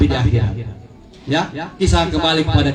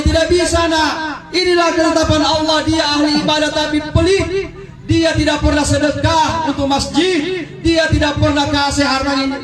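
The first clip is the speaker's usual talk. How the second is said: high raised voice declaring; stern father; 10 semitones higher than usual. Acted. Father in heaven tells his daughter he cannot help, raised. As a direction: lay down God's decree firmly.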